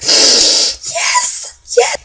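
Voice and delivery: very high, airy mock squeal; touched fan. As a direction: squeak it out as if overcome. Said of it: "touching your, your heart".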